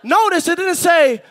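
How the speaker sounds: high, full-voice preacher's shout; shouted preaching. Acted. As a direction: drive it home to the whole church, shouting.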